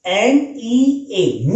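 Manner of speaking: एम ई ए drawn out letter by letter, very slow; slow spelled letters. Shown as a demonstration. Teacher spelling एम ई ए slowly for students. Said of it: "पहले मी याद रखिए आप".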